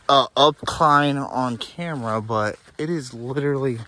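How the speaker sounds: ordinary talk, nothing marked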